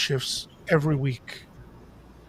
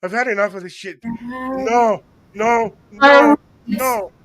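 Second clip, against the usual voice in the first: mock panicked refusal; 5 semitones higher, 8 dB louder, slower than usual. Fleeing the scary movie.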